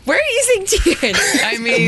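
high, drawn-out protest through laughter; real laugh breaking into a high-pitched denial. Real, not acted. Co-host teased about crying, laughing denial.

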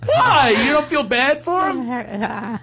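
high, hammed-up hurt squeal; mock reproach. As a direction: whine it way up high, drawn out. Mock reproach after co-host's disgust.

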